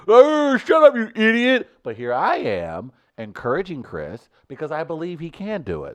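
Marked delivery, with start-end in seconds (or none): 0.1-1.7 high, loud scornful yell; mocking shout. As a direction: yell it like a heckling friend. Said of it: "you all were like".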